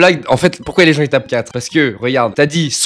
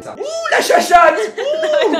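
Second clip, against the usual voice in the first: very high, drawn-out mock squeal; hammed-up mother watching the live; imagined mother reacting; much slower than usual. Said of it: "Je l'imagine comme ça".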